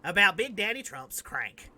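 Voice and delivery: lightly voiced red-cap Trump supporter; mock conservative guy. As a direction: play a brash Trump fan bragging. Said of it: "You could wear a red ball cap and be like".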